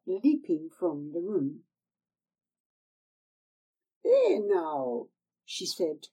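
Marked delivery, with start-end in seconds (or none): 4.0-5.1 put-on higher, wheedling old hag voice; old crone. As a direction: coax her like a sly old crone. Old woman speaks again.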